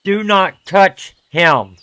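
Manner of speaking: slow, loud stern warning. Acted. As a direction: warn them off firmly. Warning NPC off his dog.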